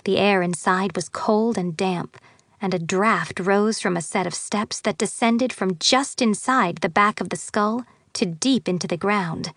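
ordinary delivery, nothing marked

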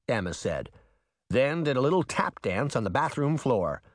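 plain reading voice

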